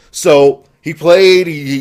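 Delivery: ordinary talk, nothing marked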